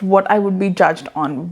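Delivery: ordinary talk, nothing marked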